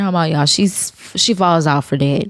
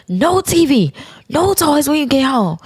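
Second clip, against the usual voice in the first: stern parent voice; 9 semitones higher than usual. Retold or imitated. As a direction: lay down the law like she's a teenager. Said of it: "talking to her like she was a teen".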